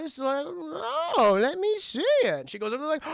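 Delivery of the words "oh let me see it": put-on much higher fussing old-lady voice; mock old lady fretting; fussing aunt. Aunt fussing over Peter's cut, high mock old lady.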